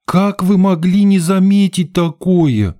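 high, drawn-out theatrical outrage; scandalized diva's reproach; indignant. A narrator's reading. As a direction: stretch it out, sustained high. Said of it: "выражая всё негодование хозяйки".

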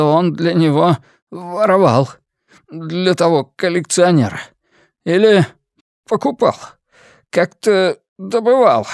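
anxious informant, talking fast; lightly voiced nervous man. A narrator's reading. Fim's nervous voice.

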